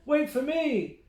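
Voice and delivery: high call after him; shouting. A narrator's reading. Mike shouts after his brother, high and loud. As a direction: call out to a brother running ahead. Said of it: "Mike shouted".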